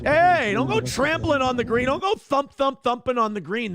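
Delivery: high-pitched mock indignant holler; scolding golfer. Golfer warning people off the delicate green, mock scolding. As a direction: play a fussy golfer telling people off.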